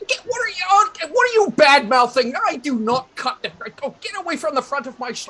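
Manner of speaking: put-on high, loud scolding from an old fellow; flustered, irate old man; indignant old shopkeeper. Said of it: "at which point the old fellow, who kind of looks like Mister Hooper".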